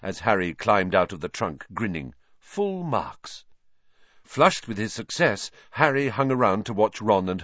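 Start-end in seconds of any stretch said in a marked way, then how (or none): 2.4-3.4 higher, gentle teacher voice; lightly voiced teacher; Lupin's voice. A narrator's reading. Lupin praising Harry.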